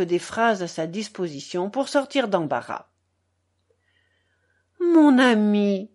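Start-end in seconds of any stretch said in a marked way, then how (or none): 4.8-5.9 slow, sweetly coaxing; drawn-out honeyed flattery. A narrator's reading. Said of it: "dit-il d'une voix mielleuse".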